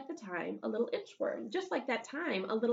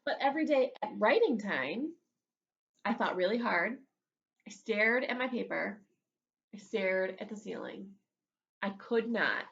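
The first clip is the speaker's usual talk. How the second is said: ordinary delivery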